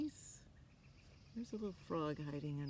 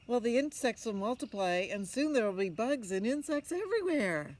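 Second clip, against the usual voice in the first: higher, louder skeptical mother; questioning mother; 6 semitones higher, 9 dB louder than usual. Acted. Mother challenging her son, higher and louder. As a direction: press him like a doubtful mom.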